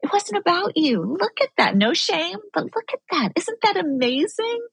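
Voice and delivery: put-on high, encouraging parent talking to a child; gentle reparenting voice. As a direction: praise yourself like a kind parent, voice lifted high. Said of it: "you're reparenting yourself".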